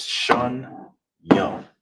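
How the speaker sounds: slow "shun yum" split in two beats; slow syllable split. Shown as a demonstration. Teacher joins the syllables of two slowly. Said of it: "So together is".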